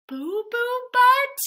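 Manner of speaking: very high, stretched-out question; comic shock, voice shooting up; incredulous echo. Acted. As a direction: repeat it in scandalized disbelief. Reader shocked echo of silly name.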